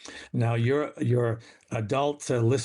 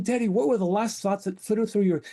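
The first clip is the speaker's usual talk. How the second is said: put-on little kid asking; 7 semitones higher than usual. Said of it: "I remember saying".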